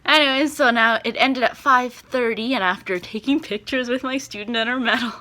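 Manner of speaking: usual speaking voice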